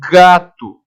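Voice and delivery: "gato" with a punched hard g. Shown as a demonstration. Teacher contrasting G with J, "gato" with a strong hard g. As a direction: lean on the g that starts "gato". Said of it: "O fonema G é aquele fonema pra".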